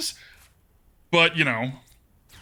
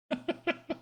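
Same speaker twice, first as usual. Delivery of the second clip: soft, high-pitched laugh; high giggle; 12 semitones higher, 9 dB quieter than usual. Real, not acted. Amused at a viewer's jump scare suggestion, high giggle.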